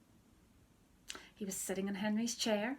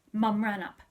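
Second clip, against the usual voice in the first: ordinary delivery, nothing marked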